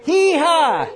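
loud, high joyful holler; exuberant whoop. Real, not acted. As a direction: let out a real whoop of glee. Whoops during laughing meeting.

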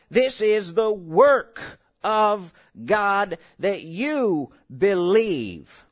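very slow, each word weighed; slow solemn quote. Acted. Preacher quotes Jesus to the church, very slow and weighty. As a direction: drag the verse out slowly. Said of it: "the original four-letter word".

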